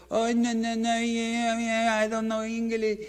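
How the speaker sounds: high put-on foreign old woman, feigning innocence; mock bag lady. Bag lady denying to the tourist, high mock voice. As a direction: play dumb in a squeaky accent. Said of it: "And she's like".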